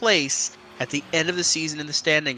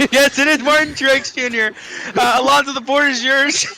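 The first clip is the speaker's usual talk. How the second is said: amused; 6 semitones higher, 5 dB louder than usual. Real, not acted. Host laughing after botched buzzer answer.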